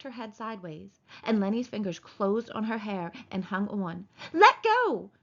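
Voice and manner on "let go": loud, very high demand; crying out. A narrator's reading. Wife trapped by his grip, crying out. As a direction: yelp it in alarm. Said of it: "she cried".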